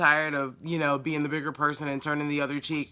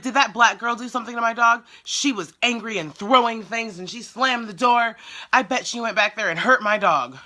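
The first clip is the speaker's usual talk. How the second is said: mock accusation, pitched up and forceful; retold customer outrage in a raised voice; accusing customer; 6 semitones higher, 3 dB louder than usual. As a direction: imitate an indignant caller, higher pitched.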